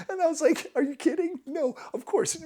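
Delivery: laughing through words, high and loud. Real, not acted. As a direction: talk while still laughing. Laughing while retelling.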